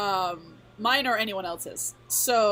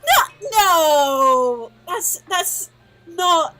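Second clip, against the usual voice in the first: flustered protest; 4 semitones higher, 8 dB louder, slower than usual. Acted.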